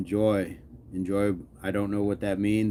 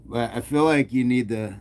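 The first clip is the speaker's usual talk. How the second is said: normal talking voice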